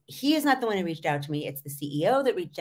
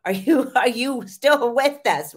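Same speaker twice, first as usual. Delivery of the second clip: louder, higher teasing call with a laugh; mock wake-up call; 6 semitones higher, 8 dB louder than usual. Coach mocks talking to a monotone speaker, loud teasing call. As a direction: poke fun at a zoned-out listener. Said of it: "monotone sound voice where it's like".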